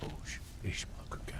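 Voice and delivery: whispered. Real, not acted. Muttering to himself while playing.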